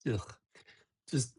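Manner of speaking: ordinary talk, nothing marked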